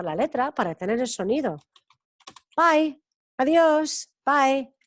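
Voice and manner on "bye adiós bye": slow, higher bye with a full ai glide; teacher modelling bye slowly; English bye. Shown as a demonstration. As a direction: stretch out bye clearly for learners. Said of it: "que es bye".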